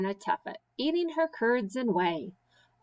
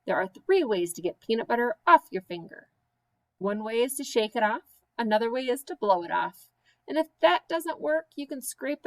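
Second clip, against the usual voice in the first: fast; faster than usual. Acted. Rattled-off rhyme verse.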